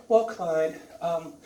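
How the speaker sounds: soft, seductive female doctor voice; soft flirty doctor. Retold or imitated. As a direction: coo at him softly. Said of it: "She comes in and said".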